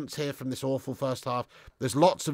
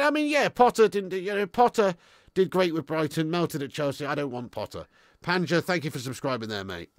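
ordinary talk

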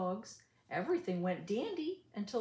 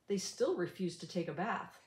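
usual speaking voice

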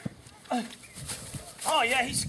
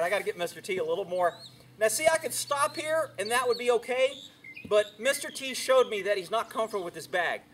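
usual speaking voice

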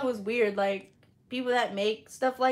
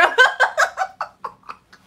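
shrieking laugh, much higher; loud high laugh; 10 dB louder than usual. Real, not acted. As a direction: burst out cackling. Bursts out laughing.

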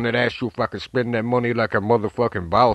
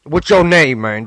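louder, higher call for the name; loud on request; 4 semitones higher, 6 dB louder, slower than usual. Acted. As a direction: shout it so the deaf guy hears. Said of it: "can you please speak a little louder".

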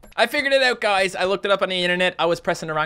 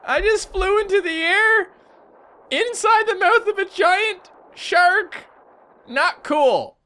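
very high, slow stunned disbelief; 4 dB louder, slower than usual. Real, not acted. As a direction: spell out the absurd thing slowly. Disbelief after flying in mouth.

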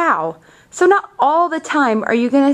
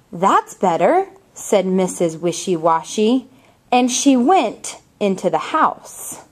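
slow, expressive storybook reading; picture book read aloud with lively character voices; reading to children; slower than usual. Acted. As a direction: read it like story time for little ones.

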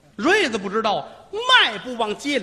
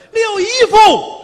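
high, loud, slow comic yell; silly wrong call; 10 dB louder, slower than usual. Acted. Son comically shouting the wrong relative's name, loud and high. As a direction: belt it out absurdly to get a laugh. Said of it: "没有这么喊的啊".

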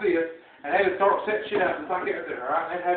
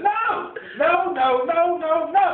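high, worked-up string of refusals; retold indignant protest; insistent refusal; 9 semitones higher, 4 dB louder than usual. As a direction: protest with voice pitched way up. Acted repeated refusal.

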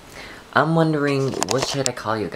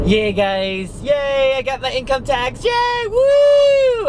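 much higher, loud squealing cheer; excited cheer; 8 dB louder, much slower than usual. Real, not acted. Vlogger cheering to viewers over her tax refund, high and loud. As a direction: squeal with joy.

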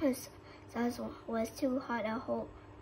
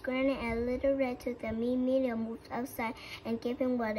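ordinary delivery

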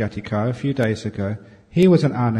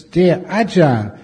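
slow, loud formal reading of a letter; reading the question; 4 dB louder, slower than usual. Shown as a demonstration. Monk reading a listener's question aloud, slow and formal. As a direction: read it out slowly to the hall. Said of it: "Now we come to the questions".

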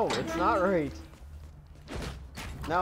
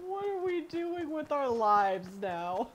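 high, giggly rhetorical question; laughing at the silliness; amused high question; 11 semitones higher than usual. Real, not acted.

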